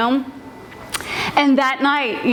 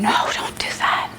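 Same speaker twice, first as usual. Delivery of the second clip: urgent whispered plea; pleading whisper; 3 dB quieter than usual. Retold or imitated. Girl begging her sister not to take the punishment, whispered. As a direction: whisper a warning to your sibling.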